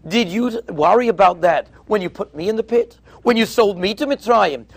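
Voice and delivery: ordinary talk, nothing marked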